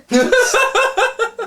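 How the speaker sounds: can't hold back the giggles; high laughing fit. Real, not acted. Laughing mid-reading at the absurd line. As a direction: burst out in a high, loud cackle of laughter.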